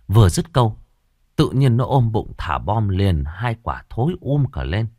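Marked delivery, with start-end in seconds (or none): none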